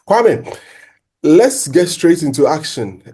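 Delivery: high, loud name call. Real, not acted. Host calling co-host to start the analysis, high. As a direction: summon the co-host.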